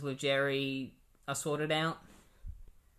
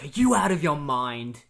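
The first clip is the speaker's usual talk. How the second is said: loud, indignant acted outburst at his dad; mock furious yell of a son; retold angry outburst; 9 dB louder than usual. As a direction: throw the line at him in disbelief. Wally confronts dad, retold quarrel.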